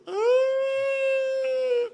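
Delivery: long, very high held scream; piercing imitation cry on one sustained note; imitated toddler wail. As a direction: mimic a child's loud wail. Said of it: "And he just".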